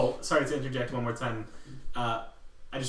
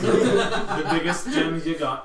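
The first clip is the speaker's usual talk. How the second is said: slow, louder, laughing greedy ask; greedy demand; 3 semitones higher, 6 dB louder, slower than usual. Acted. Player demands the biggest gem, laughing. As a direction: draw it out, greedy and amused.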